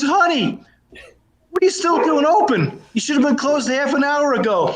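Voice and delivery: put-on high, scolding girlfriend voice; bartender's girlfriend.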